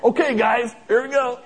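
hammed-up "let's go" to strong guys; mock rallying call. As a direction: pump the team up before the lift. Rallying movers to lift piano.

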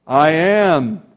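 "I am" rung out loud and full; booming declaration. Retold or imitated. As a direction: let "I am" ring like thunder. Jesus answers the arresting soldiers.

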